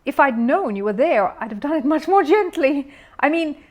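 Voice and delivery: high, loud furious bellow. A narrator's reading. Furious neighbour bellowing at the bear. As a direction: bellow it in rage.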